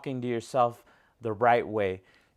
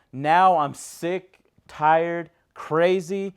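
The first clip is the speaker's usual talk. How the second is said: exasperated outburst; 4 semitones higher, 6 dB louder, much slower than usual. Retold or imitated. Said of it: "these thoughts that cross our mind".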